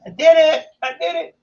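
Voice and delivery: triumphant high cheer; excited shout. Real, not acted. Triumphant shout after success.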